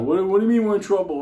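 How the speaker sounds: high, fast, loud mock panic; bugging out. Mike freaking out over being in trouble, frantic. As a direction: spill it out in a frantic rush. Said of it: "Mike is bugging out".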